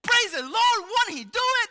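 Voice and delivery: high, loud joyful shout; hammed-up hallelujah; mock praise. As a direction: celebrate like a revival. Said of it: "I would be like".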